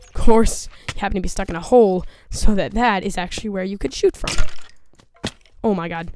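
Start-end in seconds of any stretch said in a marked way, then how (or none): 0.1-0.7 loud, fed-up "Of course"; loud exasperated. Acted. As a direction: shout "Of course" in frustration. Repeated sarcastic "of course.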